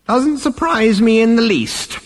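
put-on very high old man's voice; unimpressed elder brushing off a crisis; dismissive old ancestor. A narrator's reading. Old figure dismisses crisis with shrug.

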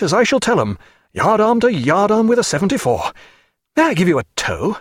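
a jovial captain gushing over the battle; Captain Muffet, triumphant. Acted.

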